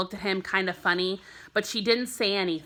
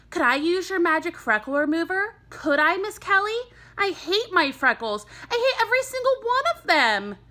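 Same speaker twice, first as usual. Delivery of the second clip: put-on higher, pleading boy's voice, building to a whiny peak; eager boy Nikki; 9 semitones higher than usual. A narrator's reading. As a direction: beg the teacher like a kid who hates his freckles. Boy voiced in a read story.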